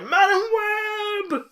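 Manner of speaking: high, loud hammed-up yell; imitating an anguished cry into the air; mock scream. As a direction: scream the name skyward, stretching it out. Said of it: "Just screams into the air".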